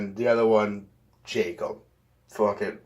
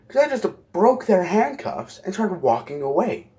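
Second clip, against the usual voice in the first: normal talking voice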